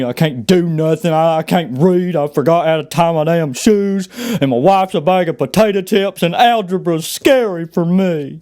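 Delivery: slow, frustrated dumb Southern voice; big dumb Southern accent. Acted. Frustrated big dumb Southern guy complaining, slow Southern accent. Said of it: "the big dumb Southern accent".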